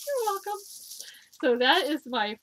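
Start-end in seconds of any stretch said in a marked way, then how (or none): 0.1-0.6 very high squeaky bunny reply; the stuffed rabbit answers sweetly; toy bunny voice. Pretend. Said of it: "thank you, John John, for being a model".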